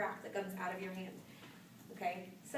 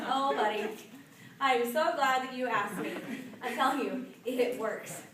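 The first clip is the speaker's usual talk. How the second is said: louder, enthusiastic sales pitch; eager pitch; 3 semitones higher, 8 dB louder than usual. Retold or imitated. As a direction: sell it with bubbly zeal. Eager pitch to curious cashier.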